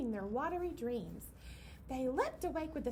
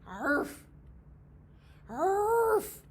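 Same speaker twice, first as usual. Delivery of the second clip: louder and louder bison bellows; bellow, then bellow louder; bison bellow, building; 6 semitones higher, 8 dB louder than usual. Acted. Grumpy bison woken too early, bellowing louder. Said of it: "he bellowed, and louder".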